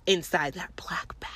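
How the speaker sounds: slow pointed whisper; hushed and deliberate. Retold or imitated. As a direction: drop to a whisper to drive it home. Arguing her case to cop.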